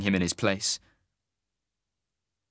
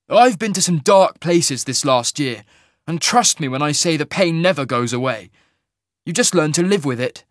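angry retort; 5 semitones higher, 6 dB louder than usual. Acted. Cole angrily dismissing the teamster's cult warning. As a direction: spit it back in anger. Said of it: "Cole said angrily".

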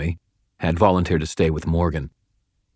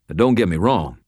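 plain reading voice

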